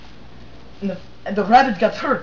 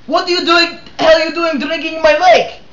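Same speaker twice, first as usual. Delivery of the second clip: angry duck; 6 semitones higher, 5 dB louder than usual. Acted. Duck confronts moose.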